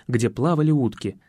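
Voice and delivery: plain reading voice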